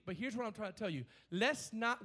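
ordinary delivery

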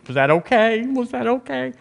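a touch of a nervous people-pleaser; anxious asking. Retold or imitated. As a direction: imitate someone fishing for approval. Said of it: "in the sense of saying".

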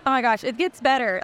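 ordinary talk, nothing marked

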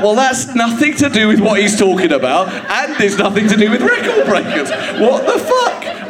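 high-pitched, exasperated disbelief; baffled, acted-out outrage climbing in pitch; incredulous outburst. Recalling his baffled outrage at question.

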